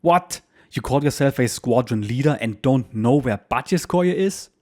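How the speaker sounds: sharp, high, loud outburst, with a Berlin edge; angry yapping. Acted. Wing commander yapping angrily at the squadron leader over the phone. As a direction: bark it down the phone in disbelief. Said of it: "the wing commander's angry voice yaps in his Berlin accent".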